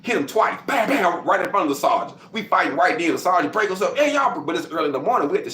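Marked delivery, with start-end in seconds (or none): 0.6-1.2 punch sound effects. Retold or imitated. Imitating two punches. 3.9-4.4 sergeant's shout. Retold or imitated. Sergeant breaking up fight.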